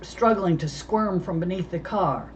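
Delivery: ordinary delivery, nothing marked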